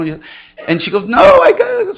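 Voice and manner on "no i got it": put-on high, louder little-girl voice; defiant little girl. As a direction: insist like a stubborn child. Child insisting, retold.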